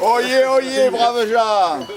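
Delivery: loud, high crier's call; town crier. Pretend. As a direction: cry it out to the crowd. Mock inauguration, crier opening.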